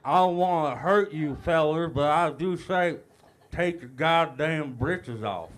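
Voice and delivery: slow, lazy Texas drawl, lightly put on; a light mock Texas drawl, stretched out. Shown as a demonstration. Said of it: "read that out in your best Texas drawl".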